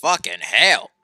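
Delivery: forceful, fed-up outburst; exasperated swearing. Acted. Acted angry outburst in quarrel.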